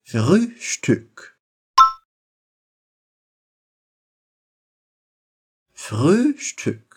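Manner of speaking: "Frühstück" with pursed lips on both Ü; "Frühstück" with two front rounded Ü. Shown as a demonstration. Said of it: "U com biquinho".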